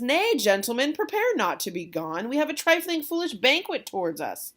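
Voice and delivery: loud, high, theatrical protest; hammed-up host calling guests back; hearty host Capulet. Capulet to departing guests, loud hammed-up protest.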